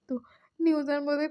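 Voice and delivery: ordinary delivery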